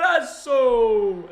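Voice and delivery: drawn-out, loud commentator cry; goal celebration. Acted. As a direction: stretch out the goal call loudly. Son celebrates a Real goal.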